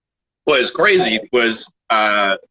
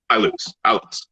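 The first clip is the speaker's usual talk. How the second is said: ordinary talk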